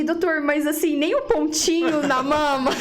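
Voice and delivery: can't believe it, voice climbing; incredulous high question. Retold or imitated. Patient asking doctor about breast spot, incredulous.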